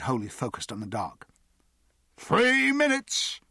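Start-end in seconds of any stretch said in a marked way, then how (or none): 2.2-3.4 high, loud, long-held call; timekeeper's call. A narrator's reading. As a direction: call the time out across the crowd. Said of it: "Captain Jimmy called".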